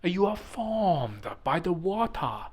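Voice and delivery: slow, high-pitched mock Chinese accent; put-on Chinese accent, drawn out like a solemn saying. Speaker quoting a beautiful Chinese saying, mock Chinese accent. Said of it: "This is my Chinese accent".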